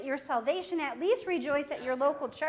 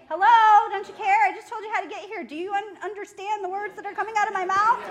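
loud, fed-up "Hello, don't you care?"; exasperated hello; 5 semitones higher, 8 dB louder, faster than usual. Pretend. A friend on the phone hearing nothing back, exasperated. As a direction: play a fed-up friend giving directions. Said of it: "Isn't that what you would say".

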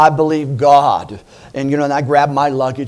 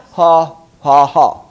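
demo laugh; 6 dB louder, slower than usual. Shown as a demonstration. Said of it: "just laugh".

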